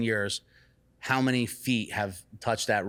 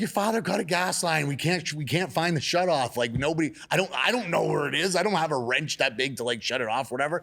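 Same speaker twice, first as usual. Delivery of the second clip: high, loud rushed alarm; retold panicked explanation; frantic explaining; 7 semitones higher, 5 dB louder than usual. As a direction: tell him in a rush of panic. Explaining gas leak in panic.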